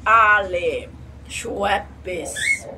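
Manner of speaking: very slow stretched product name; slow drawl. Acted. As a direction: drag out each syllable.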